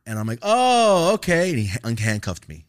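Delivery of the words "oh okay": high, loud, stretched "oh, okay"; mock acceptance. Student responds to officer's scare tactic, mock acceptance. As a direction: feign agreement while plainly put out.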